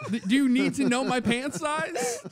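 high-pitched, laughing disbelief; laughing incredulity. Retold or imitated. Customer questioned on pant size, laughing incredulously. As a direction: retell a baffled retort, voice climbing through laughter. Retold fitting room exchange, incredulous.

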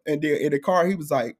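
ordinary talk, nothing marked

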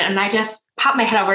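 ordinary talk

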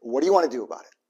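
a hint of a kind fatherly God; gentle God voice. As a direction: reassure the child kindly. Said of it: "And he'll say".

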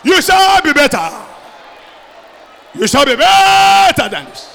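shouted prayer, stretched and loud; preaching at full force. Acted. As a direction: pray it out at full force over the crowd.

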